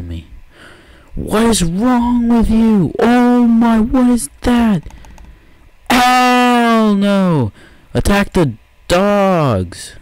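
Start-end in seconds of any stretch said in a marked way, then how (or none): 1.1-9.9 high, loud, slow panicked shrieks. Real, not acted. Scared gamer shrieking at red-eyed dogs. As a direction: shriek in mock terror.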